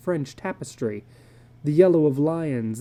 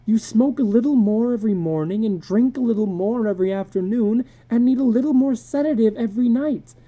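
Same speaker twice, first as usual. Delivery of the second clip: higher, strained troubled wife; anxious wife Lydia; 7 semitones higher, 3 dB louder than usual. A narrator's reading. Wife confessing to husband she feels useless, anxious. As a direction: pour out her worry about the house.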